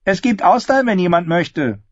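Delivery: high, loud call to the room; loud announcement. A narrator's reading. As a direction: call out an offer, pitch falling at the end. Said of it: "als Cat laut sagte". (laut und hoch in den Raum gerufen)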